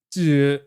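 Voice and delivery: "du" sliding upward from low; rising tone. Shown as a demonstration. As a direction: start "du" low and lift it. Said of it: "there's different pitches".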